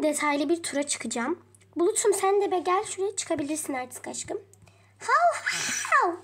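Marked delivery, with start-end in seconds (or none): 5.0-6.1 high loud yapping; yappy dog greeting; excited dog bark. Pretend. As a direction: bark like a happy puppy.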